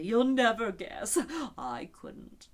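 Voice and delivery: loud, slow eager cry fading to a whisper; bursting to tell; eager unicorn. A narrator's reading. Unicorn eagerly teasing the lion, loud then hushed. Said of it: "the unicorn cried eagerly".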